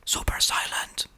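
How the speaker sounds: whispered coaching cue; super silent whisper. Shown as a demonstration. Parent coaching a child learning play lines, whispering. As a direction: whisper it like secret advice to a kid. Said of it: "give advice like, "Super silent".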